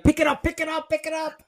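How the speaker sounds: high, urgent pleading; excited urging. Retold or imitated. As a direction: squeal at them to grab it. Urging friends to answer.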